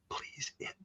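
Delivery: slow, pleading whisper; hushed desperate plea. Retold or imitated. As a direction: beg under your breath to the control room. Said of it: "and he goes".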